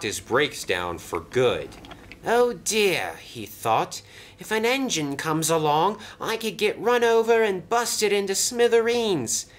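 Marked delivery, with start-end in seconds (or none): 2.3-3.2 high-pitched, mildly anxious exclamation; worried dismay. A narrator's reading. Stranded brake van fears a crash. 4.4-9.5 fretting, climbing to a high pitch; rising panic. A narrator's reading. Stranded brake van fears a crash.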